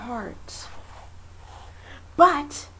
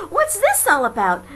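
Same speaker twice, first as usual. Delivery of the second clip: louder excited call; shouting crowd; 3 semitones higher, 8 dB louder than usual. A narrator's reading. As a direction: call out in amazement. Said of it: "the people came shouting".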